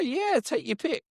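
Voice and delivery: high, lightly mocked sudden eagerness; eager turnaround. Don changes his mind after seeing money, eager. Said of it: "changed his mind from being insulted to".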